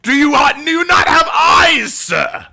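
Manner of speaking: loud, high, drawn-out disbelief; mock outraged shout. Streamer arguing back at people who reject female heroes, shouting in mock outrage. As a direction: yell it slowly in exaggerated outrage.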